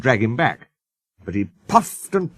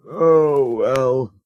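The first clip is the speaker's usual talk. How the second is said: sulky; 2 semitones higher than usual. A narrator's reading. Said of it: "said Sir Handel sulkily".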